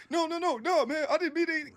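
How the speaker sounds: very high, loud mock pleading; panicked protest. Player protesting before getting kicked, high panicked imitation.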